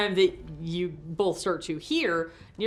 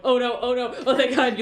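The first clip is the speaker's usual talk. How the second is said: higher, louder acted panic turning to relief; mock panic to relief; 7 semitones higher, 8 dB louder than usual. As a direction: play frantic dread, then sudden relief. Game master acts out panic then relief.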